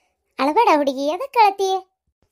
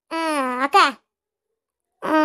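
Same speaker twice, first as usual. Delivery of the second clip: ordinary talk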